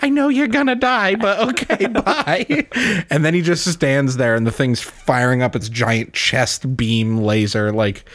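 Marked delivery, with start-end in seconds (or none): 0.0-2.7 very high, laughing through it; Tracer voice. Retold or imitated. Tracer saying a laughing goodbye to Winston, very high. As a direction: squeak it out, half giggling. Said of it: "and she's like".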